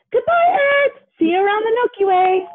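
very high, calling goodbye from afar; shouted farewell. A narrator's reading. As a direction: sing out the farewell as you leave. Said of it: "he shouted".

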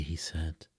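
ordinary delivery, nothing marked

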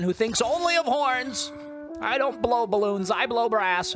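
high, excited voiceover for the stuck hornist. Retold or imitated. Gamer voicing the panicking hornist, high and excited. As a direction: squeal it like a frantic musician. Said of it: "He's like".

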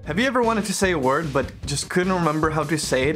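ordinary talk

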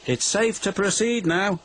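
high-pitched all-clear; calling out. A narrator's reading. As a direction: call the all-clear over. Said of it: "called the fireman".

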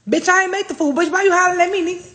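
high, loud indignant shout; fed-up server yelling at a diner; outraged yell. Acted. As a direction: blow up at the customer. Ranting at complaining diners.

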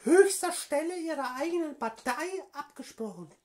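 höhere, gespielte Politikerinnenstimme; Frauenstimme. Acted. Prank call as AfD woman. (verstellte Frauenstimme am Telefon)

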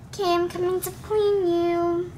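usual speaking voice

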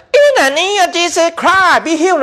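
pretend faith healer. Preacher imitating a pretend healer, mock high proclamation. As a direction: put on a faith healer's high, stretched-out cry. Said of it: "lấy pretend".

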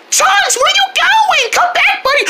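high frantic shout after the runaway dog; alarmed high shouts; panicked shouting. Acted. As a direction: yell for the dog to come back.

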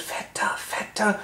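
slow, soft stage whisper. Retold or imitated. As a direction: whisper a tip from behind. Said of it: "jemand von hinten flüstert". (langsam geflüstert)

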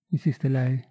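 ordinary delivery

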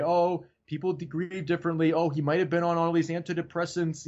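put-on excuse-making voice; mock excuse-maker. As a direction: rattle off excuses as someone else. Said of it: "people can make excuses all day".

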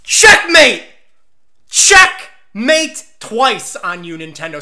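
loud, high gloating yell; triumphant shout. Acted. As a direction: crow it like you just won.

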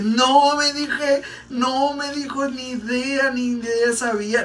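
high-pitched imitation of a stunned client; astonished client. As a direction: play her disbelief high and drawn out. Clienta sorprendida, voz imitada. (clienta asombrada, imitada en tono agudo)

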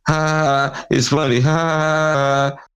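high, sarcastic fake laugh; mock laughter. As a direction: laugh back at them scornfully. Mocking the trolls who laugh.

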